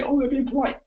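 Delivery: high-pitched mock dismissal; mimicked protest. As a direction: imitate her brushing off praise. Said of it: "Don't just say".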